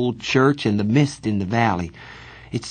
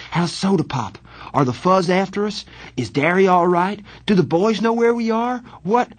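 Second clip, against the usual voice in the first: higher, rising run of urgent questions; eager questioning; 9 semitones higher than usual. Acted. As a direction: fire off questions, climbing in pitch.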